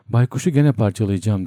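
ordinary delivery, nothing marked

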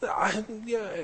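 high, drawn-out hedge; mock-uneasy stalling; backing off. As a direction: imitate someone suddenly unwilling to commit. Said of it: "he's backing off".

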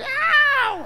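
very high, loud cat screech; imitated cat yowl. Pastor imitating the cat that woke him, yowling. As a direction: yowl suddenly like a startled cat. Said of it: "un gato gritó en mi".

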